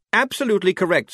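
high, quick and bright; brightly. Acted. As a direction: chirp it out cheerfully. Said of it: "he said brightly".

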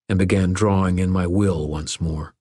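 ordinary delivery, nothing marked

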